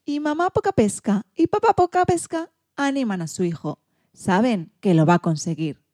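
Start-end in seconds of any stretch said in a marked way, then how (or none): none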